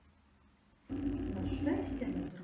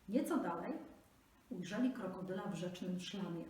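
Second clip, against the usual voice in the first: ordinary delivery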